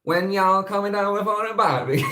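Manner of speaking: hammed-up, high southern holler, about to crack up; southern accent attempt. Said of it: "accent I'm doing here".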